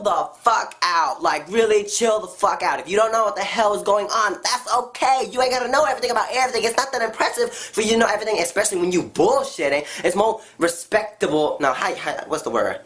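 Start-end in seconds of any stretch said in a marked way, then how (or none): none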